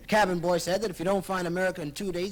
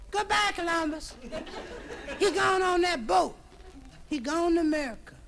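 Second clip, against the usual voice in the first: much higher, squealing farewell; screaming girls; 10 semitones higher than usual. Acted. Girls on the pier seeing Chris off, high squeals. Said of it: "They're all screaming".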